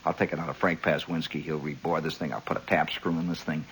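soft, low muttered plans; quiet planning to yourself. Retold or imitated. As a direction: talk it through under your breath. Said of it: "You say".

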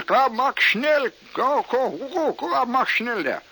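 high, loud German orders; mock German accent. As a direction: hurry him along in fake German. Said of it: "it catches you after a while".

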